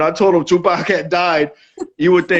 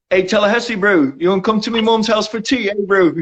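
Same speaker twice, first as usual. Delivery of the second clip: higher-pitched mock New Zealand accent; put-on Kiwi accent, pitched up; 4 semitones higher than usual. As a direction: imitate teasing Kiwi lads with their accent. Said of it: "I got you, bro".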